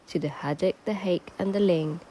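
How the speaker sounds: usual speaking voice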